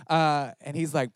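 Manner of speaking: usual speaking voice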